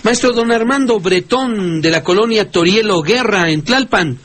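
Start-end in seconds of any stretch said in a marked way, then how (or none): none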